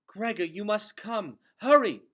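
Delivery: high, loud and urgent. A narrator's reading. Luxa pulling Gregor to the hospital, urgent cry. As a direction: hurry him along, pleading. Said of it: "she said".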